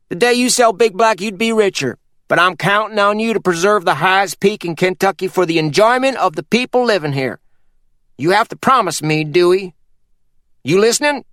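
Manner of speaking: put-on a sly old mountain man giving orders; Purvis voiced. Purvis demands a promise.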